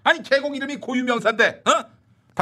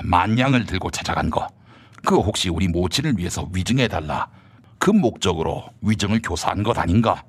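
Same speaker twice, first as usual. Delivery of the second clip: low stern minister; 13 semitones lower than usual. Acted. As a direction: press him like a cold interrogator. Low voiced rival character.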